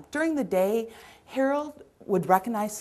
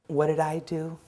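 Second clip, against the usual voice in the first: ordinary delivery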